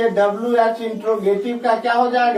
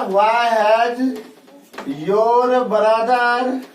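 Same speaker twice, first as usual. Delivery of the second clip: drawn-out model phrase; much slower than usual. Shown as a demonstration. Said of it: "drawn-out English model".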